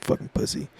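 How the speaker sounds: a breathy whisper of contempt; whispered insult. Retold or imitated. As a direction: say it under your breath so the cop barely hears. Muttered insult at cop.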